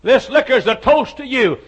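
loud, high, mocking toast with a laugh; a swaggering barroom toast to Jesus; drunk man's toast. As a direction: raise the glass and crow it out, laughing.

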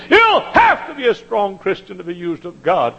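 loud, high preaching shout. Real, not acted. Preacher shouting to his congregation at full force.

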